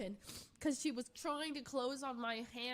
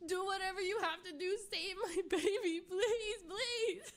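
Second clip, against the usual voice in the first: high, louder pleading with a laugh; desperate begging; 7 semitones higher, 4 dB louder than usual. Retold or imitated. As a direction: beg the vet, high and urgent. Begging vets to save cat.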